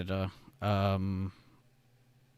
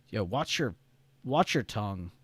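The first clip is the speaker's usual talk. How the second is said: ordinary delivery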